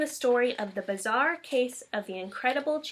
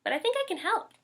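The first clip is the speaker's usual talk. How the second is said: brightly; 8 semitones higher, much faster than usual. A narrator's reading. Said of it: "she said brightly".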